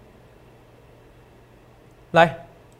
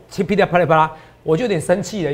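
usual speaking voice

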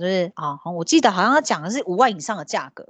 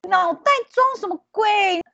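exploding with anger; 9 semitones higher than usual. Retold or imitated. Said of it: "我听到这个我就爆炸".

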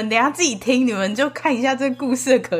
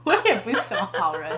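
mocking echo; 3 semitones lower, 3 dB quieter, faster than usual. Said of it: "mock echo of her line".

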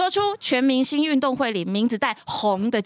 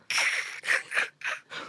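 silent laugh; 8 dB quieter than usual. Real, not acted. Cracks up before question.

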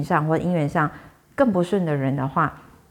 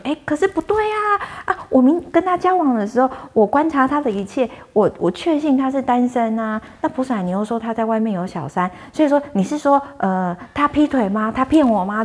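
distressed disbelief; 7 semitones higher than usual. Retold or imitated. Woman protesting to the bodhisattva that her boyfriend seemed single, high and distressed. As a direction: voice climbing in dismay as the news sinks in. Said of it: "那个女的就越沮丧".

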